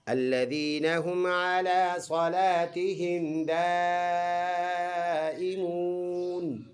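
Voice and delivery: slow melodic tajweed recitation of the full verse; slow chanted Quranic recitation. Shown as a demonstration. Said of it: "فل آیت ہم نے ایسے پڑھنا ہوگا".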